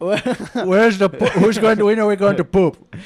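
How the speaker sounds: high, loud complaint in a put-on Indian accent; hammed-up indignant customer, pitched well up; impression of audience member. Guy in the audience demanding to know where the poop is, loud accented impression. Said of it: "This is my impression of some guy".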